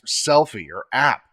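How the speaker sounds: usual speaking voice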